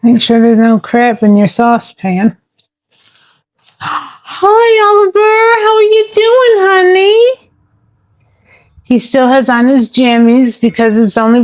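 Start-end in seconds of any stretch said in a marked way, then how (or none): 3.8-7.5 high, sing-song pet voice; sweet high cooing greeting. Real, not acted. Greeting her dog in baby talk.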